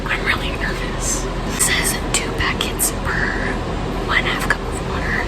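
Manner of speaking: rushed, hushed late-night whisper; frantic whisper. Real, not acted. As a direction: whisper fast so the parents stay asleep. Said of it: "I have to whisper 'cause my parents are sleeping".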